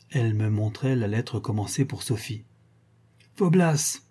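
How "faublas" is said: high-pitched call of a cross father. A narrator's reading. Baron calling to his son behind the door, crying out. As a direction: call the name out sharply, pitched up. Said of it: "cria le baron".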